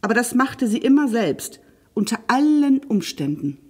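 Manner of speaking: usual speaking voice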